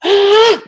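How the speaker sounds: short high, loud scream; demonstrated burst of frustration, loud and high; venting scream. Shown as a demonstration. Parent screaming into a pillow instead of scolding, short loud scream. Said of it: "saya mulai".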